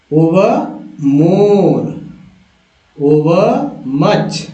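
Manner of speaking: each word stretched very slowly. Shown as a demonstration. Teacher stretching each word slowly for students to copy. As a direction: draw every word out for learners to copy. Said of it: "उसको मैं पढ़ के दिखाता हूँ".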